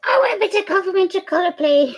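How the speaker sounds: high, fast cartoon dog voice; voicing the dog. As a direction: speak for Alfie cutely. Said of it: "Alfie says".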